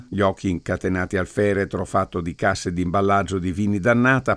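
plain reading voice

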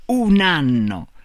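"Un anno" slow and loud; emphatic repetition. A narrator's reading. Man drives it home to Marta, "Un anno" slow and emphatic. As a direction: draw out "Un anno" with weight, falling. Mocking revelation repeated to Marta.

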